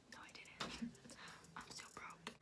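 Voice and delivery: barely voiced confession to camera; whispered aside. Real, not acted.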